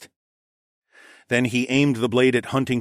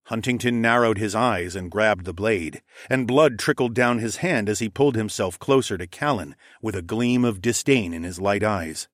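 ordinary delivery

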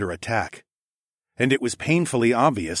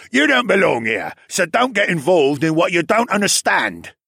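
raised, loud, hostile creature voice; shrieking polar bear; 9 semitones higher, 6 dB louder than usual. A narrator's reading. Polar bear warning the hero away, angry shouting. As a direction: yell it to warn off an outsider. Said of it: "the polar bear demi-human shrieked".

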